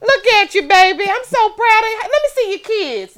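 high, loud, proud elder; hammed-up gushing old relative; old hometown relative. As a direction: fuss proudly over a grown-up baby. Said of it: "and they like".

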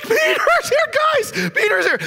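high, breathless excited announcing; squealing with joy; overjoyed. Acted. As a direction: she bursts in with the news. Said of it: "And she's like".

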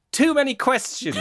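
very high-pitched mock-angry outburst; high, indignant joke voice; Aggressive Piglet. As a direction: squeal the punchline in comic fury. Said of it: "An aggressive Piglet replies".

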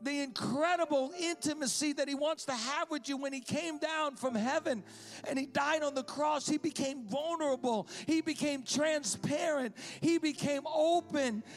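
high, driving sermon delivery; fervent preacher building in waves; impassioned preaching. Real, not acted. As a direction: proclaim it to the whole room, punching key words. Preaches God's love at full force.

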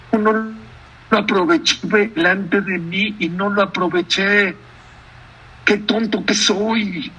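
a touch of mock weeping, voice rising toward the end; hammed-up regret of a man who missed his chance; lamenting host. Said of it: "Y se pegaba y lloraba". (lamento exagerado de Rubén que no aprovechó al rey)

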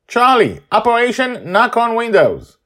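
put-on high, loud eager girl voice; rally your buddy like a kid on a mission; excited child calling. Child Joy calling out orders.

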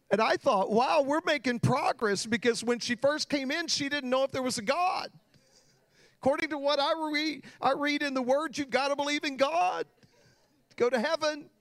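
high, loud preaching build; rising preaching fervor. Real, not acted. Preacher to congregation, building fervent and loud.

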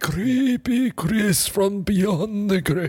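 high, slow spooky ghost voice; drawn-out creepy voice from the grave. Pretend. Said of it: "you gotta be like the creepy, creepy Chris".

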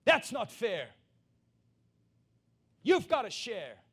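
put-on high, loud childish complaint; mock whiny demand. Preacher mimicking people demanding fairness, loud whiny voice. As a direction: whine it like a spoiled child demanding. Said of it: "what men demand from each other".

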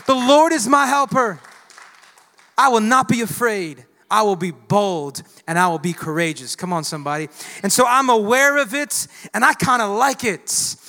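loud, rising sermon build; fired-up pastor in full cry; preaching at full force. Acted. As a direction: preach it to the rafters.

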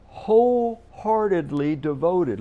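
"wholeheartedly" loud, slow and drawn out; stress on wholeheartedly. Real, not acted. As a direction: hammer "wholeheartedly" out syllable by syllable. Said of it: "Notice again the emphasis".

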